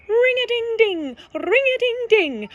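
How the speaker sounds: high, loud ringing bell imitation; sustained high sing-out of bells; imitated bells. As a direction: ring it out like cowbells. Narrator imitates cows' ringing bells.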